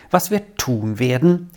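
ordinary delivery, nothing marked

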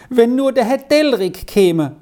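put-on high, wistful child's lament; sighing wish. A narrator's reading. Child outside longing for help, sighing. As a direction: wish it aloud, high and plaintive. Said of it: "seufzte es draußen".

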